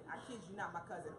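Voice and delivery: ordinary talk, nothing marked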